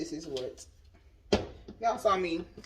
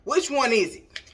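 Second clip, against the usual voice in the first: exasperated; 7 semitones higher, 8 dB louder than usual. Real, not acted. Confused by conflicting instructions.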